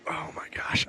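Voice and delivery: soft unvoiced whisper. Real, not acted. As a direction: breathe it out in a whisper. Whispering, overwhelmed by heat.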